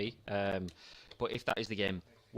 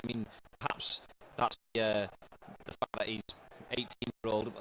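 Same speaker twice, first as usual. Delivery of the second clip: usual speaking voice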